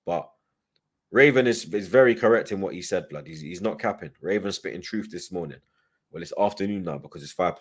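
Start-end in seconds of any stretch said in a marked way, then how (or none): none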